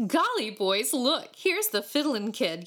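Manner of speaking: a mild gleeful yell; excited yell. A narrator's reading. A boy spotting the fiddler for his friends, yelling. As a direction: boyish shout of discovery, kept light. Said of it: "yelled one".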